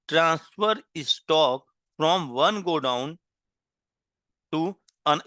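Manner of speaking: words stretched right out; very slow dictation. Shown as a demonstration. Teacher dictating the heading to students, drawn out very slowly. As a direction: drag every word so they can write it down.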